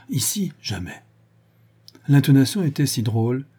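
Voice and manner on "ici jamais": hushed, aghast half-whisper; choked with shock. A narrator's reading. Man refusing her room, choked and hushed. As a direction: softly gasp out the refusal. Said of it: "Et suffoqué, il reprit encore".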